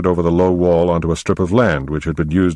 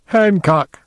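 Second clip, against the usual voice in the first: laughing hard. A narrator's reading. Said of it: "began laughing so hard she was doubled up".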